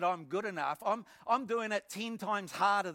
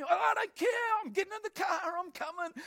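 high-pitched, loud burst that cuts in; frantic insistence; 12 semitones higher, 3 dB louder than usual. Retold or imitated. Caller overriding the psychiatrist's objection, acted frantic insistence. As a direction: mock-desperate cry, refusing to be put off.

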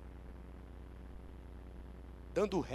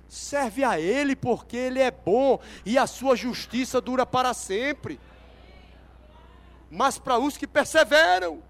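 loud, raised, emphatic proclamation; preaching at full force; 3 semitones higher, 10 dB louder than usual. Real, not acted. Preacher urging the church to serve God, forceful preaching. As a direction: drive it home to the whole congregation.

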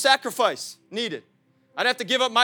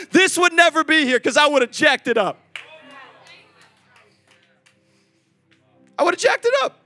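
preaching shout; 6 semitones higher, 6 dB louder than usual. Real, not acted.

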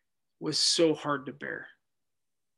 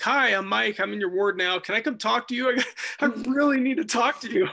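higher and louder, bubbling with nervous eagerness; eager greeting; 7 semitones higher, 7 dB louder than usual. Retold or imitated. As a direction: burst in keen and a little giddy. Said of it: "I'm like".

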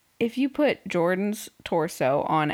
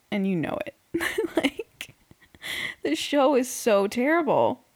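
ordinary talk

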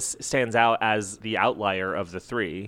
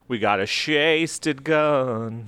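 silly slow mumble; 3 semitones higher, much slower than usual. Pretend. Playful mangled words, laughing.

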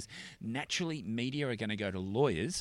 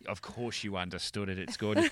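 ordinary delivery

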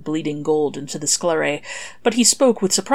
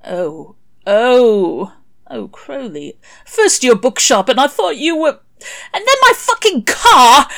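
rising to a high, loud wail; anguished outburst; 9 semitones higher, 9 dB louder, slower than usual. Acted. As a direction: grief spilling over into a wail. Crowley breaking down over losses.

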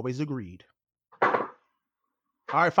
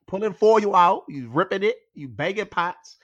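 exasperated complaint; 7 semitones higher, 5 dB louder, slower than usual. Real, not acted. Complaining about her noise.

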